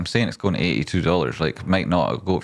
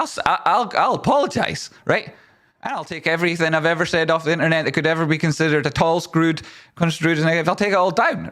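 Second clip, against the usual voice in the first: exasperated rant; 9 semitones higher than usual. Real, not acted. Ranting at critics on stream.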